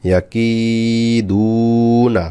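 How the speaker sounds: "কি" and "দু" held long, "না" short; one-alif stretch. Shown as a demonstration. Teacher demonstrating madd letters, long "কি" and "দু", short "না". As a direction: draw out the vowels of "কি" and "দু". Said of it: "এক আলিফ করে টান হবে".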